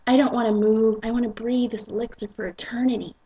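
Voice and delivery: usual speaking voice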